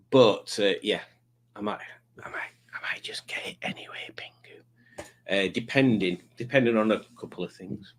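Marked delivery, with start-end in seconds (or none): 1.5-4.3 whisper. Real, not acted. Whispered aside to Pingu.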